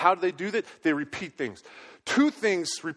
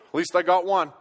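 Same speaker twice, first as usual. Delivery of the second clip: ordinary talk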